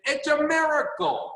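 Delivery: lightly voiced mentor, pitch lifted high on miracle; a hint of Jim Rohn marvelling; Jim Rohn's way of saying it. Quoting his mentor Jim Rohn to a Zoom team, lightly imitating his wonder. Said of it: "That's how he would talk".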